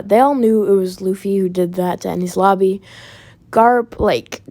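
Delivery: ordinary talk, nothing marked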